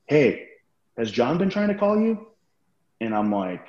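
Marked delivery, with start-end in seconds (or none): 0.0-2.3 high mock question. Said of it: "he goes".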